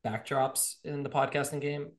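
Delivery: normal talking voice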